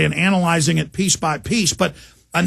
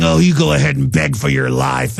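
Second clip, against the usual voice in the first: a hint of a gloating movie psychopath; lightly voiced torturer taunting his victim; mock movie psycho; 4 semitones lower than usual. Host imitates a film psycho taunting a strapped-down victim, mildly sinister. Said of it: "Hollywood's over-dramatizing psychopaths".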